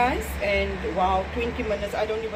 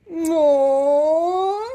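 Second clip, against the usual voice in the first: high, loud laugh close to tears; laugh-cry; 8 semitones higher, 7 dB louder than usual. Real, not acted. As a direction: laugh through happy tears.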